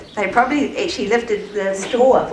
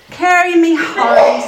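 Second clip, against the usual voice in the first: dismayed wail; 6 semitones higher, 6 dB louder, slower than usual. Acted. Discouraged man asks friends.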